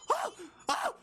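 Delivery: ordinary talk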